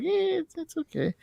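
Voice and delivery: high, soft, unimpressed eh; damn it with faint praise; airy high lukewarm verdict; meh shrug. Retold or imitated. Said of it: "I'm like".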